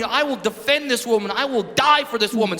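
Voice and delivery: ordinary talk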